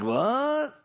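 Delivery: high, startled cry; shocked what. Retold or imitated. Preacher hearing of a swamp people show, startled. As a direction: jump up in disbelief. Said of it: "I was like".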